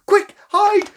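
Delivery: high, loud panicked warning; panicky. Acted. Tilly warning the girls the tiger is coming, panicky high cry. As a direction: sound the alarm in a fright. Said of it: "she said in a panicky voice".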